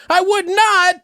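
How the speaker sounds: very high mock indignant woman; hammed-up squeaky denial; mock indignant retort. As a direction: imitate her retort way up high. Woman's retort in retold quarrel.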